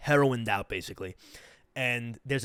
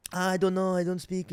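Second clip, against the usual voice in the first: high, held-level mock voice of a woman; feigned ignorance; 6 semitones higher than usual. Woman stonewalling a stranger asking for a hookup, feigned ignorance. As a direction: play clueless in a higher, put-on voice.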